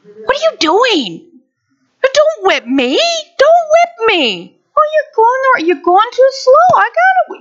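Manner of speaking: high, indignant horse voice; complaining horse. Acted. Horse protests to rider about spurring, high indignant voice. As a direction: protest in a high, hammed-up whine. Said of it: "all of a sudden your horse".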